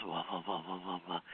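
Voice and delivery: very soft, low repeated woofs; quiet, low doggy pulsing; imitating a dog. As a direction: do a dog's hushed "whoa-whoa". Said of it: "And he goes".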